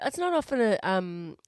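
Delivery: usual speaking voice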